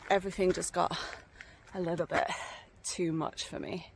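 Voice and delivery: ordinary talk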